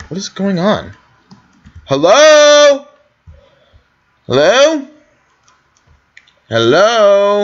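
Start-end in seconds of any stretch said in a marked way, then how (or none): 1.8-2.9 loud, high, drawn-out hello; high, sustained searching shout; calling out. Pretend. Calling into an empty town. 4.3-7.4 high, rising searching hello; raised-voice questioning call. Pretend. Calling into an empty town.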